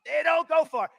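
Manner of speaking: high, loud mocking blast; mocking shout. Shown as a demonstration. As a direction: yell it out in scorn. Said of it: "That's about as accurate".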